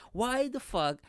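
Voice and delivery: high exasperated outburst. Real, not acted. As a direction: throw up your hands, why. Exasperated rant peak.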